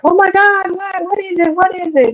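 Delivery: high, frantic mother's voice asking what's wrong; panicked mom. Retold or imitated. Mom panics over screaming child.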